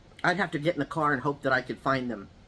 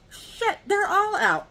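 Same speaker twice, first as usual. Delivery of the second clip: high, slow dismayed curse; neighbor dismayed; 13 semitones higher, 3 dB louder, much slower than usual. Retold or imitated. Neighbor finding his dogs loose, high slow dismay. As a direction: draw out the neighbor's groan. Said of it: "He comes out of the she shed".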